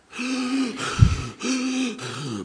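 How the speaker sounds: imitating labored gasp. Shown as a demonstration. Preacher showing the congregation how Fred breathed, labored gasp. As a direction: gasp like a dying man on oxygen. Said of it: "Breathing like this".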